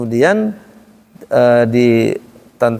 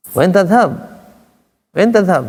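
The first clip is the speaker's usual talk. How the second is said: higher, arching question tune on TADHHAB; questioning intonation; 6 semitones higher than usual. Shown as a demonstration. Ustaz shows Arabic questions have intonation, high lilt. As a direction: ask it with a high swoop on TADHHAB. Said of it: "Semua juga ada intonasi".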